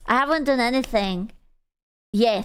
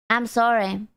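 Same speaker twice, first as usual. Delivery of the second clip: ordinary talk, nothing marked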